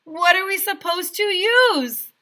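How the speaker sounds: high, loud outraged question; shouted question. A narrator's reading. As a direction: demand an answer. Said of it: "shouted Alexia".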